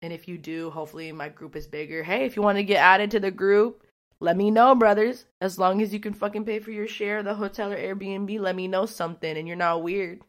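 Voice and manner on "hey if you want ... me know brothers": loud call to the viewers; louder, rallying call to the crew. Real, not acted. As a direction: shout out the invite. Calling out to viewers.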